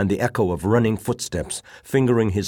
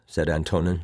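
plain reading voice

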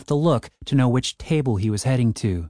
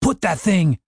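higher, appalled order; mild shout; 6 semitones higher than usual. A narrator's reading. Dave to Cooper, appalled mild shout. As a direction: order him urgently, pitch up. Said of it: "shouted Dave".

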